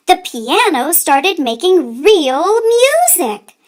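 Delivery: plain reading voice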